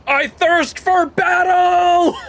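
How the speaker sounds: high, slow, loud war cry; battle cry. Acted. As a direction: bellow it like a hero charging. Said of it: "battle cry, slow and high".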